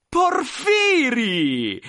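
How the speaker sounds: loud, high, long-drawn shout; high drawn-out hail to an old friend; joyful exclamation. Acted. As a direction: cry out a friend's name in delighted recognition. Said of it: "esclamò il grosso".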